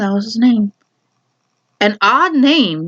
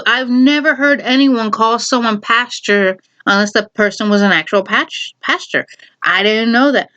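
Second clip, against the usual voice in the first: ordinary talk, nothing marked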